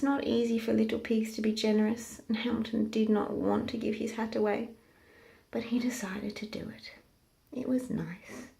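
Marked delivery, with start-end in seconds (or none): 5.5-8.5 whisper. A narrator's reading. Narrator reluctant hush.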